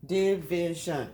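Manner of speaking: "Division" slowly in three separate parts; syllable by syllable. Shown as a demonstration. Teacher recapping the sounds for pupils, "Division" syllable by syllable. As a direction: break "Division" into di, vi, shun. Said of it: "then I heard shun".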